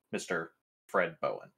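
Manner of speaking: usual speaking voice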